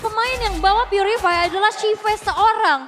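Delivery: loud, high excited call; esports caster. Real, not acted. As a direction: hype up the play. Caster hypes the play.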